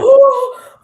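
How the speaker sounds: loud high startled gasp; sharp gasp. Retold or imitated. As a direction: gasp as if a snake just hissed. Reenacts snake encounter.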